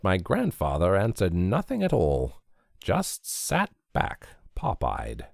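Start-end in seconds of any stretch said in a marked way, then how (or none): none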